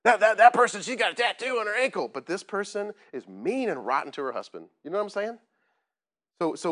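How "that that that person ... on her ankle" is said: high, loud mock tattletale; judgmental accusation. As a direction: imitate a scandalized churchgoer, stumbling over the first word. Acting out judgmental churchgoers.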